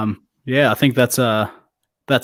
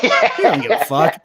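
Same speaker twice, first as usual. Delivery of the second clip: higher, laughing through it; laughing retort; 5 semitones higher than usual. Retold or imitated. Co-host mocking Pippen's non-apology, laughing. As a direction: toss it off mid laugh. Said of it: "It's like".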